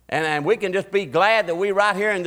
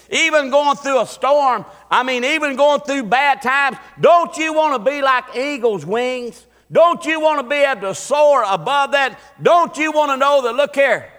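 fiery preaching; 5 semitones higher, 4 dB louder than usual. Acted. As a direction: build the sermon up high and loud. Preaching at full force.

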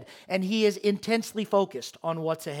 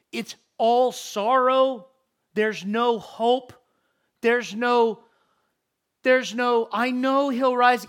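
preaching at full force; 4 semitones higher, 5 dB louder than usual. Acted. Preacher voicing Mary's hopeless sorrow.

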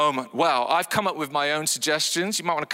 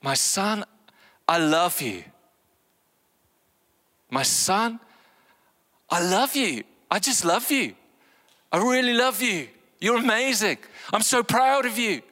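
tender, fond father's voice; God telling his son he is loved, tenderly; loving father; 5 semitones higher than usual. Acted. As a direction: pour love over a son. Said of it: "The voice from the cloud says".

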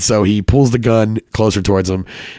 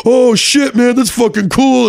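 put-on amazed frat boy; 13 semitones higher, slower than usual. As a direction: gush over the golden picture. Frat boy sees the golden photo.

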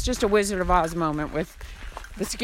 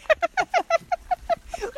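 high burst of laughter. Real, not acted. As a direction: giggle high and bright. Laughing at the question.

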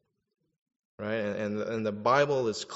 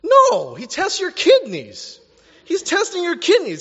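very high, loud shout; shouted correction; 11 dB louder than usual. Real, not acted. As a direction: shout it out to the congregation. Preacher's emphatic correction.

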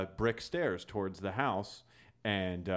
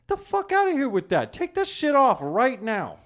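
very high, loud shout, slowing on the order; furious yell; 9 dB louder than usual. Retold or imitated. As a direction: scream it at the thief. Yelling at Jamie to remove jacket.

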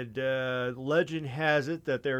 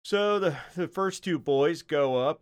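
ordinary talk, nothing marked

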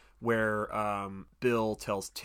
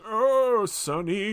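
put-on high, loud caricature of an old man; hammed-up old-timer; 10 semitones higher, 6 dB louder, much slower than usual. Shown as a demonstration. Podcaster mocking the clichéd old-age performance, high hammed-up old-timer. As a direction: wail it like a cartoon grandpa. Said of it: "it wasn't just them doing".

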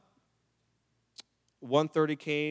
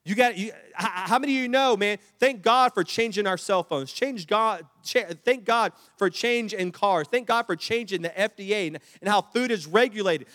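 full-force preaching; 6 semitones higher, 9 dB louder than usual. Acted. Pastor preaching thanks for change to the church, loud and high. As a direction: thunder it out to the congregation.

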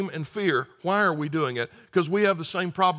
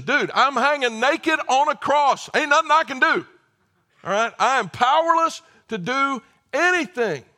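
the thief telling heaven's questioners he could do nothing, loud and exasperated; 9 semitones higher, 7 dB louder than usual. Acted. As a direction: throw up your hands in frustration.

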